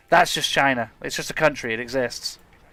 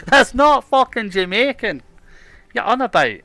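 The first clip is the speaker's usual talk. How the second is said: put-on Jamaican accent; 8 semitones higher, 4 dB louder than usual. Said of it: "You've gotta stop doing Jamaican accents".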